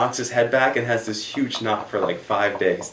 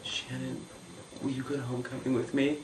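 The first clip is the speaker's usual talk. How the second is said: timid, soft, higher-pitched ask; barely muttered; 6 semitones higher, 9 dB quieter than usual. Retold or imitated. Nervous boy asks cheerleader to homecoming, barely muttering. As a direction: squeak out the question very quietly. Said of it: "I, like, barely mutter".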